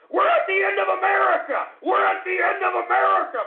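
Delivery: high, loud scream of outrage; desperate screaming. Real, not acted. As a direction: shriek it like a doomsday warning. Enraged rant at trolls.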